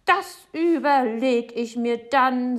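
loud, slow, majestic pronouncement; haughty. A narrator's reading. Neighbour leaving Papa with a threat, haughty and slow. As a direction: declare it grandly, with drawn-out words. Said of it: "sagt Frau Segebrecht hoheitsvoll".

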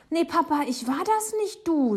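high, loud, alarmed denial; urgent protest. Acted. Boy telling Papa it wasn't him, urgently pleading.

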